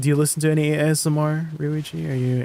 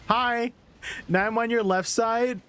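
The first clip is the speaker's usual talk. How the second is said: high, soft ASMR murmur; softly teasing ear to ear; 5 semitones higher, 5 dB quieter than usual. Pretend. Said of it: "just have your headphones ready".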